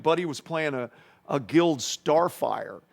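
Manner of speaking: ordinary delivery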